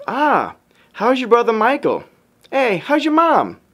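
high, friendly mock accent; playful high-pitched accent imitation; Brother John's accent. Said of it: "how cute his accent kinda is".

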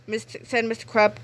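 usual speaking voice

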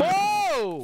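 high, drawn-out whoa; shocked protest. Pretend. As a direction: recoil with a high yelp.

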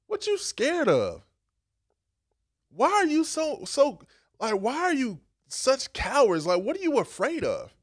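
incredulous questioning. Retold or imitated. Jesus questioning the disciples.

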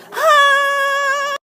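high, squeaky "Hi"; mock greeting. Girls greeting the camera in a roast video, squeaky fake-sweet "Hi". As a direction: chirp "Hi" like a fake-sweet popular girl.

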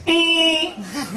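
high nasal-free hum like a door buzzer; imitating a door. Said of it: "y la puerta hace".

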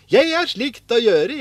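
high, loud, singsong retort; eager insistence. A narrator's reading. Askeladden tells his brothers he will keep it, insistent. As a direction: stand up cheerfully for your find.

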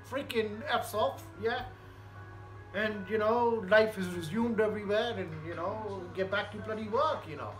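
relaxed Punjabi north indian accent. Real, not acted. Punjabi speaker signing off.

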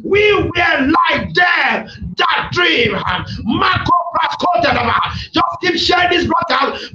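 high, driving prophetic declaration; fervent preaching. Real, not acted. Preacher telling listeners their turn has come, fervent preaching.

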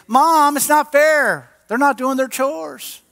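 high, drawn-out mock whine of a child; complaining kid. Kid tattling to Mom about chores, mock whining. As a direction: protest up high like a wronged kid. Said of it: "when you're a kid".

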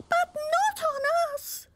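very high squeaky oyster voices; little oysters crying out. A narrator's reading. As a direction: squeak it like tiny worried oysters. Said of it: "the oysters cried".

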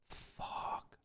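breathless whispered fuck under the breath; real frustrated whisper; whispered curse. Real, not acted. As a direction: hiss it to yourself in frustration. Trade going against him.

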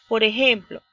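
ordinary talk, nothing marked

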